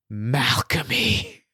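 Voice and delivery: ordinary talk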